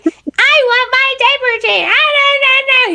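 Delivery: put-on high whiny two-year-old voice; whining toddler. Toddler demanding a diaper change, high whining. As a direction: whine and complain like a toddler. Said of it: "they're just whining and complaining".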